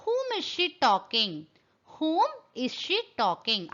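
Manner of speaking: each word spaced out slowly for learners; slow, careful model question; slow dictation. Shown as a demonstration. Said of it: "examples பாருங்க".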